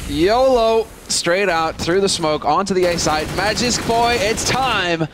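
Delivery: hyped-up shouting play-by-play; excited. Real, not acted. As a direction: yell it as the play breaks. Hype play-by-play call.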